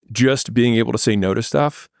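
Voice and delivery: usual speaking voice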